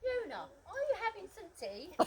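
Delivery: Tony's mock posh English tea offer; English accent. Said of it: "saying to me in an English accent".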